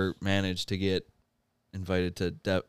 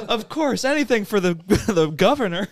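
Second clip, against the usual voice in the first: eager, high voice; 12 semitones higher, 6 dB louder than usual. Acted. People answer the governor eagerly.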